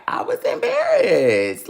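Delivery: high, loud wail of embarrassment; indignant outburst. Retold or imitated. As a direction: wail it out in exaggerated protest. Reliving embarrassment, protesting to mother.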